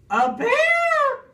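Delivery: very high, loud storybook scream. A narrator's reading. Eddie coming face to face with bear, screaming. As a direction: shriek it at the top of the range. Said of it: "screamed Eddie".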